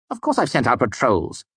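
a mild irritated snap; snapped. A narrator's reading. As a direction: bite the words off at him. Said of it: "he snapped".

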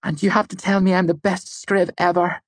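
high-pitched, teasing demand for praise; playful demand. A narrator's reading. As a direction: insist on a compliment in a high voice.

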